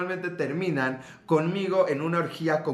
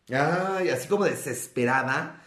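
normal talking voice

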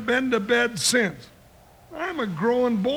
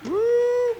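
impressed whoo; 10 semitones higher, 3 dB louder than usual. Acted. Impressed by the knockout punch.

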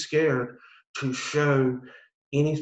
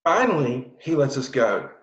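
ordinary talk, nothing marked

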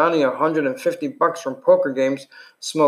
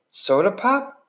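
bewildered; 7 semitones higher, slower than usual. Acted.

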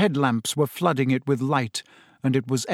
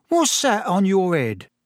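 higher, drawn-out blunt question; a touch abrupt, pitch raised; 5 semitones higher than usual. A narrator's reading. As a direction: ask it abruptly, out of nowhere. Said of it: "said Stan abruptly".

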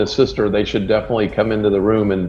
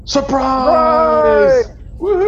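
very high, loud party yell; surprise shout; 5 dB louder, much slower than usual. Acted. Guests yell surprise at Tassan. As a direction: jump out and yell for the birthday.

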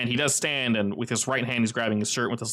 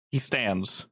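usual speaking voice

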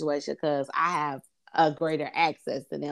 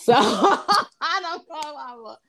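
talking through laughter, higher and drawn out; laughing; 9 semitones higher, 4 dB louder, slower than usual. Real, not acted. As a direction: let a laugh slow the words down. Laughing at her own retold look.

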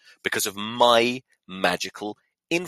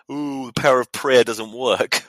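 louder, higher mock complaint; put-on jeering voice; mocking objector; 4 semitones higher, 5 dB louder than usual. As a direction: imitate a sneering doubter. Mocking imagined objectors to his superstition.

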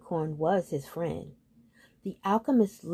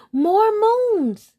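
very high, loud squeal of wonder; thrilled exclamation; 13 semitones higher, 7 dB louder than usual. A narrator's reading. Smaller hill seeing the sky full of moons, thrilled. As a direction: cry out in high delight.

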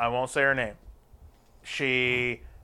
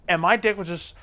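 ordinary talk

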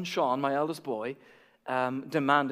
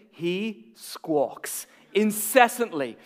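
usual speaking voice

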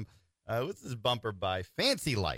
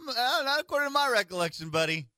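mock sing-song; 13 semitones higher than usual. Mock-sung retort to Biden.

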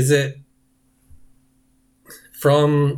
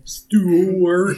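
Stuart voice demo; 5 semitones higher than usual. Shown as a demonstration. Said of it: "moving your mouth in spots it shouldn't be".

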